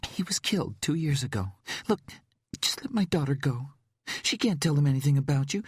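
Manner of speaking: ordinary delivery, nothing marked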